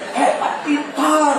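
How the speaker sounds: admiring praise. Retold or imitated. Husband praising wife to friends.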